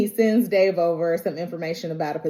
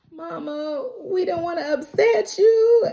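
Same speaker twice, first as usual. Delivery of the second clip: high, placating imitation; children soothing their upset mother; pleading kids; 9 semitones higher than usual. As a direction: coax mama gently, mock sweet.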